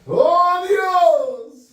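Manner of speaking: theatrical false-piety cry; mock pious wail. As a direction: overdo a fake holy lament. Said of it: "Yo conozco mucha gente así son".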